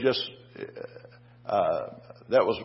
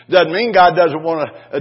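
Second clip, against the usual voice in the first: usual speaking voice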